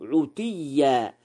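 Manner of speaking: عتيا said 'utiyya; damma on ayn. Shown as a demonstration. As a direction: begin عتيا with a rounded "u" after the ayn. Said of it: "بضم العين".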